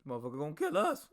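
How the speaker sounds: sudden high yelp, then a rushed panicked mutter; mock panic. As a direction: act out the recruit's horror at the drill sergeant. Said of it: "But I was just like".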